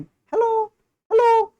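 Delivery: very high, thin squeaky hello. Shown as a demonstration. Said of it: "जैसे मैं अभी बोल रहा हूँ बहुत पतली आवाज में".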